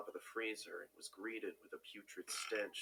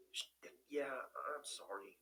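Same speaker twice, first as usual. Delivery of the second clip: whisper; 5 semitones higher, much slower than usual. A narrator's reading. Hurt toe, caught by roommate.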